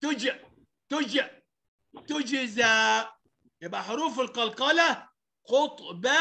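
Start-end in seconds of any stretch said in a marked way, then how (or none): 0.1-3.0 تجزى with the ج bounced; qalqalah bounce. Shown as a demonstration. Teacher showing qalqalah to pupils, bounced ج in تجزى. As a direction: make the ج in تجزى echo briefly. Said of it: "يعني بهزها".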